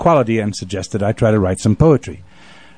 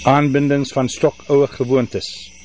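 slow, solemn Afrikaans recitation, higher pitched, long pauses; poem recital in Afrikaans; 4 semitones higher than usual. Acted. Poet reading the Afrikaans version of his poem, slow and solemn. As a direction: chant the verses in Afrikaans like an old lament.

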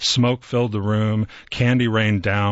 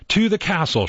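high, delighted cry; gleeful cry; 9 semitones higher than usual. A narrator's reading. Queen crying out in glee as she steals Esther. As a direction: crow it out in triumph. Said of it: "she cried".

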